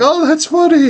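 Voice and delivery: high-pitched mock audience voice; amused audience reaction. People reacting to an improvised gag, mock amused squeal.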